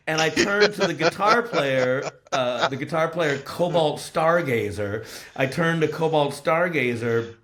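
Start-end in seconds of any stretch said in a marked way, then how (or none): none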